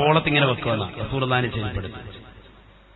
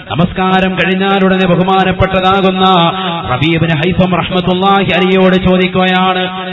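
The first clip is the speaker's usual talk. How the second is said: louder, higher preaching build-up; rising sermon delivery; 5 semitones higher, 7 dB louder than usual. Acted. Preacher introducing the question put to the sick companion, loud rising sermon voice. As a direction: swell into a loud preacher's chant.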